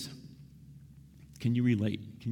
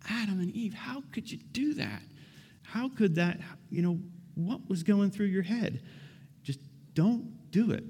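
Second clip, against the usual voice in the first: high, incredulous plea; how could you, high and pained; exasperated pleading; 10 semitones higher than usual. Pretend. Preacher pleading with Adam and Eve, high and incredulous.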